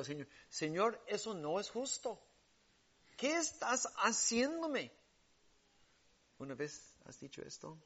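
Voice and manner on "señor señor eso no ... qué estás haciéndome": rising, pleading protest to God; complaining prayer. Retold or imitated. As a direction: whine at God it's unfair. (queja a Dios, cada vez más aguda)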